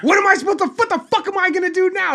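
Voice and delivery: high, loud frantic panic; mock panic. Staffer told to make it happen, squealing in mock panic. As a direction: squeal it out like you're in trouble.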